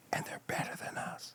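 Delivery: whispered aside, voiceless and hushed; secret whisper. Retold or imitated. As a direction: lean in and whisper the tip like a secret. Lady tips them off secretly.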